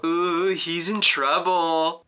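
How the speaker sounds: slow, drawn-out taunting singsong; mock a kid who's in trouble; teasing classmate.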